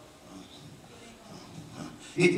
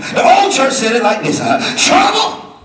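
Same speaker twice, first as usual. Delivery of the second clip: higher, louder preached quotes; preaching sayings; 8 semitones higher, 7 dB louder than usual. Acted. Preacher calling out old church sayings, loud preaching.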